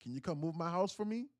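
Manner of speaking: a hint of a sugary ex asking a favour; ex-girlfriend voice. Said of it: "being like".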